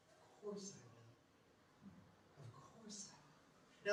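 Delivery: very soft, slow near-whisper; hushed, unhurried comfort; tender reassurance. Acted. Jesus answers a plea for mercy, tender reassurance.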